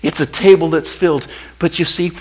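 ordinary talk

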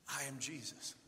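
low, soft, hushed reveal; the Lord's answer, barely above a breath. Acted. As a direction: let it fall to a hush.

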